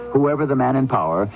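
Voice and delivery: usual speaking voice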